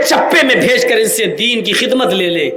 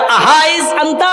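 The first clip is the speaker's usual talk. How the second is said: repeat the question; 7 semitones higher, slower than usual. Retold or imitated. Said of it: "حضرت عمر نے کیا کہا؟".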